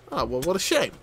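usual speaking voice

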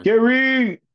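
high, loud holler to a friend; drawn-out playful yell; playful shout-out. Pretend. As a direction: call his name out loud and high. Playfully calling out to Gary Machuda.

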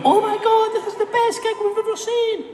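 high gushing fan impression. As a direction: squeal it like an adoring fan. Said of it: "You're gonna go".

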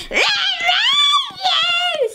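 put-on high, wailing creepy old woman; creepy Granny voice. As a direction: wail it like a haunting granny. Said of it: "her voice is so creepy".

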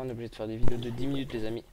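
loud, fast grumble to the audience; mock exasperated announcement. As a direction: rush it out, loud and fed up. Addressing viewers, sudden loud fast.

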